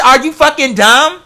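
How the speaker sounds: ordinary talk, nothing marked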